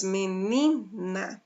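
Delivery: "menina" slowly, drawing out the "ni"; stress on middle syllable. Shown as a demonstration. Teacher showing the class the strong syllable, "menina" with long stressed "ni". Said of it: "sílaba forte no ni".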